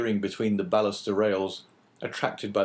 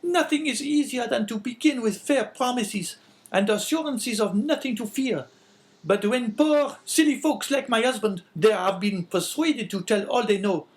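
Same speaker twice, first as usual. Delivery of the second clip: much higher, louder, harsh woman's voice; sickly scolding wife; 13 semitones higher, 4 dB louder than usual. A narrator's reading. Wife calling down the stairs at husband, scolding. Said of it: "retorted the woman".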